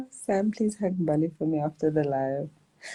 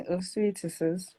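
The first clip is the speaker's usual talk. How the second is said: ordinary delivery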